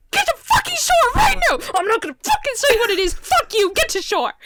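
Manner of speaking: high-pitched panicked commands; re-enacted frantic yelling. Canoer orders friends to shore, frantic shouting. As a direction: shout urgently, refusing to explain.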